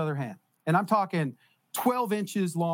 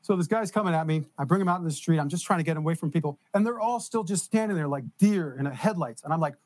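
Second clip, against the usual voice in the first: normal talking voice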